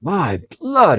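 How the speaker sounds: irritated outburst; vexed exclamation. Acted. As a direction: blurt it out in annoyance. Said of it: "ejaculated the vexed coachman".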